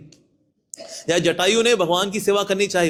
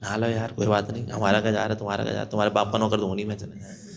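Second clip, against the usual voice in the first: listless grumbling; 9 semitones lower, 6 dB quieter, faster than usual. Retold or imitated. Listless devotee sulks and leaves.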